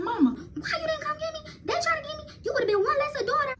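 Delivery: very high, wailing, near sobs; tearful teen blaming her mom; whiny crying accusation. Retold or imitated. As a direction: wail at your mother like a hurt child. Bursting into mom's room crying.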